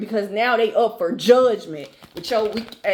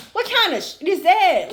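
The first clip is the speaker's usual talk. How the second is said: high, outraged disbelief, pitched way up; what kind of nerve is that, higher; sharply higher indignant demand; 7 semitones higher than usual. Real, not acted. Outraged rant at the betrayer.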